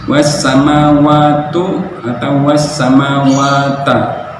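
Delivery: slow was samawatu, was samawata; wrong vowels. Shown as a demonstration. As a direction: show the wrong endings. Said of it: "dia bacanya". (bacaan salah harakat)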